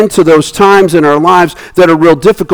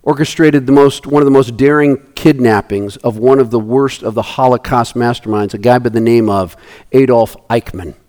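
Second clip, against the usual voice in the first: normal talking voice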